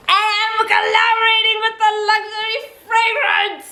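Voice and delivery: high-pitched triumphant squeal; uncontained excitement. Real, not acted. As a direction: announce it way up high, bursting with excitement. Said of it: "I can't contain my excitement".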